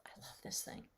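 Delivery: soft unvoiced whisper, as if sharing a secret. Real, not acted. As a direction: breathe the words, almost no voice. Intimate aside about a treasured object.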